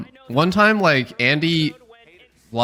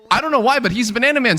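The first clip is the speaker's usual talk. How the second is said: much higher and fast, carefree; excited mock quote; 10 semitones higher, faster than usual. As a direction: toss it off like a cheerful kid. Players adopting the nickname.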